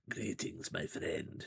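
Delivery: soft, husky Eastern European fortune teller; a light Eastern European lilt, hushed and soothing; husky Eastern European accent. A narrator's reading. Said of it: "very husky yet soothing Eastern European accent".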